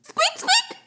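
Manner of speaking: high squeaky mouse call; mouse squeaks. Acted.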